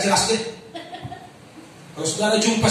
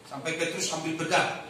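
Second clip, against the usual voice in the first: normal talking voice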